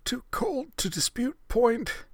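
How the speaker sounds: slow, high shivering whisper. Acted. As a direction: breathe it out, too cold to talk. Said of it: "Ritsu whispered".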